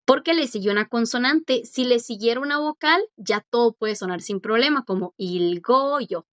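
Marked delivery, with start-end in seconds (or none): none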